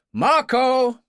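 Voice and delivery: a loud, high call to a servant. Acted. Morgan calling Marco from inside, a loud, high call. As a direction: call the name out across the house. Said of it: "He raised his voice and called".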